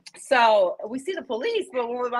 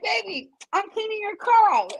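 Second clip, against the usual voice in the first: mock sweet; 13 semitones higher than usual. Friend sweet-talks the client.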